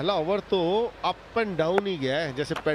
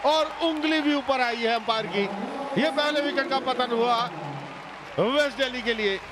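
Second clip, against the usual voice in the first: excited play-by-play; 9 semitones higher than usual. Acted. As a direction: call the big moment at full pitch. Live call of shot and wicket.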